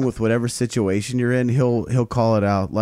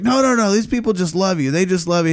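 higher-pitched placating quote; imitated host's reassurance; 7 semitones higher than usual. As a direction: play a host hurriedly soothing a guest. Voicing host reassuring the comedian.

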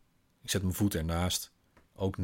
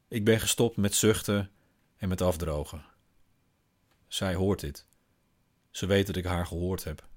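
plain reading voice

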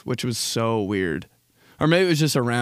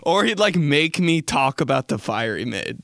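ordinary delivery

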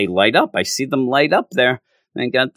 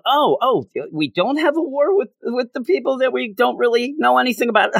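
mocking the comic; 12 semitones higher than usual. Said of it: "Now you're just throwing out".

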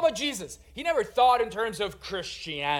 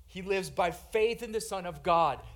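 ordinary talk

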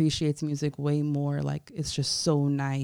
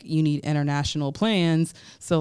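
usual speaking voice

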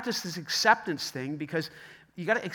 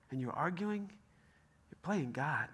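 ordinary talk

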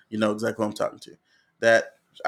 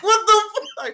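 high, loud laughing what the fuck; high laughing exclamation; shocked laughing yell; 7 dB louder, slower than usual. Retold or imitated. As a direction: yelp it in shocked disbelief. Shocked at brother at window.

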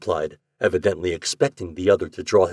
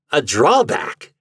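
scornful echo, drawn out slowly; ugly chuckle; 3 semitones higher, 6 dB louder, slower than usual. A narrator's reading. Garvey interrupting the secretary, with an ugly relish. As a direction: throw the word back slow and loud. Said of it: "with an ugly chuckle".

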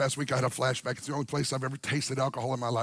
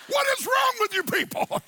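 much higher, louder teasing cry; playful outraged shout; 4 dB louder than usual. Real, not acted. Pastor teasing the congregation, playful outraged shout. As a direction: throw up your hands at them, laughing.

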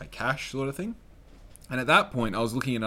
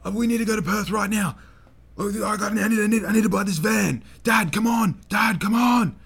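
loud, high, frantic pleading; high-pitched yell, begging and stumbling over words; yelling at Dad; 10 semitones higher, 6 dB louder than usual. Retold or imitated. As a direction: plead urgently with Dad at a raised pitch, building to a shout. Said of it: "I'm yelling at him".

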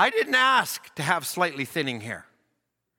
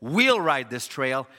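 we'll drawn out slowly, then the rest lower; stress on we'll; 4 semitones lower, slower than usual. Shown as a demonstration. Preacher showing congregation three readings, we'll slowly emphasised. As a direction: lean slowly on the word we'll. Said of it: "he says it three ways".

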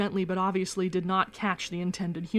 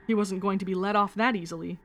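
usual speaking voice

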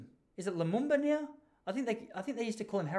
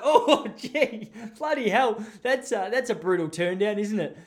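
high, loud mock dismay at a brush-off; mock shock; 4 semitones higher, 5 dB louder, slower than usual. Recalling a girl turning down his drink offer, mock dismay. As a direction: act stunned by the refusal.

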